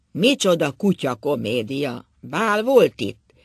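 loud, slow mocking jeer; rough, drawn-out jeer at the "ball"; loud drunken sneer; mocking outburst. A narrator's reading. Drunk husband mocking, then laughing.

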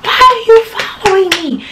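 high mock tell-off to a stalker; retold angry yell. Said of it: "So I'm like".

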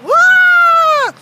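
high, long "ah"; hammed-up shriek; mock terrified scream. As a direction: scream as a frightened cartoon character. [name] screams again.

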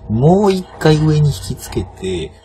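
talking himself through the lever, slow and insistent on 回; slow coaching stress on 回. Shown as a demonstration. Gamer coaching himself, 回 drawn out slow.

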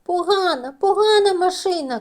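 put-on high, loud cross child; angry shout. A narrator's reading. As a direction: stomp out the blame at the car. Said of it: "кричить Тім". (сердитий високий дитячий крик)

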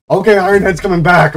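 lively, somewhat loud announcement; excited. Real, not acted. Gamer announcing Iron Head's comeback, excited. Cheering game player's comeback.

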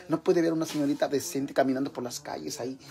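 normal talking voice

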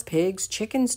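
plain reading voice

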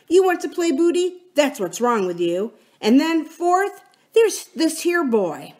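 accusing, with high pitch jumps on each point; aggrieved mutineer. Acted. George lists the crew's grievances to Silver, accusing. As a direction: lay out each charge emphatically.

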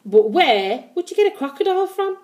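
high-pitched puzzled question; mildly puzzled query; wondering. A narrator's reading. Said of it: "wondered Missus Cave".

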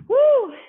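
high, loud whoop of triumph; excited woo. Real, not acted. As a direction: cheer at the finish. Last exercise finished.

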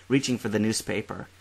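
usual speaking voice

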